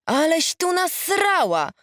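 high, drawn-out dismay; appalled exclamation. A narrator's reading. Old friend reacting to Ada's messy house, appalled. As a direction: exclaim it slowly, pitched right up.

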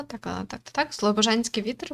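usual speaking voice

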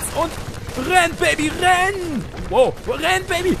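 high loud frantic run call; panicked yelling. Real, not acted. Gamer fleeing the Strider, panicked high yell. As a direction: scream at himself to flee.

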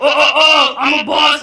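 hammed-up boss bragging; mock tough boss. Mocking the rival's tough-girl act.

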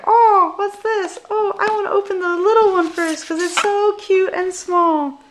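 high, loud, delighted over a tiny box; excited cooing. Real, not acted. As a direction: coo at it like a cute surprise. Opening gifts on camera.

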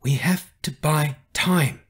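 slow, tight whisper; barely moving mouth. A narrator's reading. Carrie to Riker under enemy eyes, tight slow whisper. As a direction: whisper it through a still mouth. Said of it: "trying not to move her mouth".

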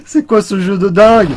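exasperated exclaim. Real, not acted. Exclaims after dying.

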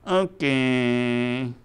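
long, drawn-out puppet reply; lightly voiced puppet drone; puppet character. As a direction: stretch it out as a reluctant puppet. Puppet Mikey answers the host.

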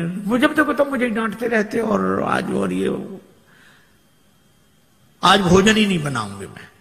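mock quarrel. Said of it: "जोर जोर से इन्होंने झगड़ा करना".

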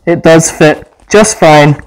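speaking through mask filter; muffled through a gas mask. Real, not acted. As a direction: voice behind full face mask. Seen 2: Full-face gas mask worn throughout; R frames show bare face, masks handheld.

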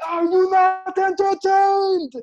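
huge, high, drawn-out shout to the crowd; triumphant stage roar. Acted. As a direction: bellow it like an arena champion. Re-enacting his big stage entrance.

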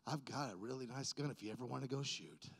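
lightly voiced friend, low and soft; quiet, low friend's offer. Friend Paul offering a gun, low and soft. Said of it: "He goes".

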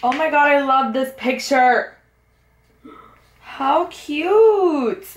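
high, loud gushing over the picture; excited gush. Real, not acted. Fan gushing at her favourite photo in the album. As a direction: swoon over it.

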